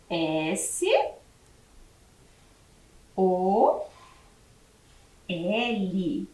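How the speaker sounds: each letter said on its own, long pauses between; dictating letters to a class; spelling out letters. Shown as a demonstration. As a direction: spell it slowly, letter by letter, for young pupils. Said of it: "Como eu escrevo sol?".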